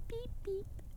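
very high, soft squeak; squeaky little character voice; tiny thumb-sized boy. A narrator's reading. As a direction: peep like a tiny hidden creature. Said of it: "sa Tommeliten".

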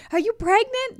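high, loud excited ask; excited question. Retold or imitated. Callers asking excitedly.

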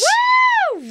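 loud high whoop; excited cheer. Real, not acted. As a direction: cheer like you just won. Cheering at Vegas prize.

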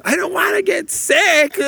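high, slow whining diva; dramatic diva. Retold or imitated. Dad hams up his daughter's fear of getting sick, high slow whine. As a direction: whine it like a melodramatic teen. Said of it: "I'm being dramatic".

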